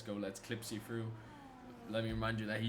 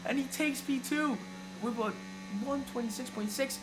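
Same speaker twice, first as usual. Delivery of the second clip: higher and louder rapid results call; rising excitement; 9 semitones higher, 4 dB louder than usual. Real, not acted. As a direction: ramp up as the times land. Live qualifying results flood in.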